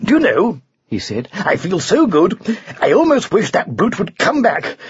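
high, puffed-up swagger; boastful knight. Acted. Knight brags after the lion has fled, boastful. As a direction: brag in a high, excited voice.

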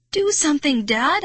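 high, urgent plea; pleading. A narrator's reading. Girl pleading with dad for Marty, urgent. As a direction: beg a parent to act. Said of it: "I pleaded".

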